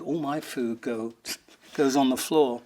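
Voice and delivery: ordinary talk, nothing marked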